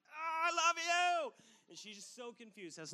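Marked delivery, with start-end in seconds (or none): 0.1-1.3 high, slow, relieved "I love you"; relieved hug. Retold or imitated. Dad finding his lost daughter, high and slow with relief. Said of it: "and I'm like".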